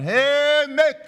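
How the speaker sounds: high-pitched call across a field; a high hail to Mick across the field. Acted. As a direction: call out to a neighbour in the dark. She calls out across field.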